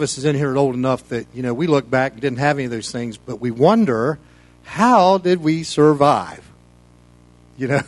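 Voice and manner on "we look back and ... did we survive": usual speaking voice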